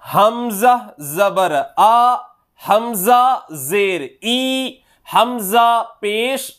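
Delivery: slow, drawn-out chant that rises on each vowel mark; qaida recitation drill. Shown as a demonstration. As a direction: sound out each syllable for students to copy. Teacher recites hamza drill. (నెమ్మదిగా, రాగంతో అక్షరాలు పలకడం)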